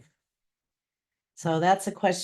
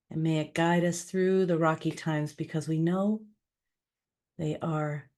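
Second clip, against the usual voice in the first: spoken prayer. Acted. Leading listeners in prayer for those suffering, calm and measured. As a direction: lead a calm, unhurried prayer.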